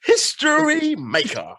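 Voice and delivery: high, slow, loud proclamation; a big cheer for a first-time return; excited shout. Real, not acted. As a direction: hail her like a champion. Host hails returning guest.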